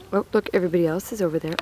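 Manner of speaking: usual speaking voice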